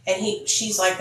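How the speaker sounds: usual speaking voice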